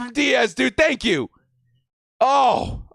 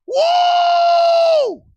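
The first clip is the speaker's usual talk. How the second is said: very high, long "woo"; ecstatic whoop; 5 dB louder than usual. Real, not acted. Fan celebrates his team's pick, ecstatic whooping. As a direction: whoop at the top of your range.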